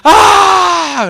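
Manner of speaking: high, loud startled yelp; startled shout. Retold or imitated. Pastor voicing the son's shocked yelp at being called in.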